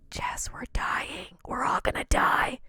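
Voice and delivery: hushed, unvoiced warning of doom; whispered doom. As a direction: whisper it like the end is near. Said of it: "he just goes".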